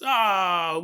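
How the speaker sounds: ordinary delivery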